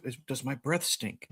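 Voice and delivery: softly voiced inner question; hushed retold thought; worried private thought. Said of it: "thinking to myself".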